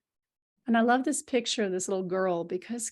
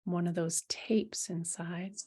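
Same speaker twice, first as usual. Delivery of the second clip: whispering; 3 semitones lower, 6 dB quieter than usual. Real, not acted. Whispered line.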